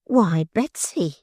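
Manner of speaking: slow, lightly voiced old woman; a hint of a suspicious mother-in-law. A narrator's reading. Mother-in-law to her daughter, lightly voiced old woman. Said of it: "said the old woman".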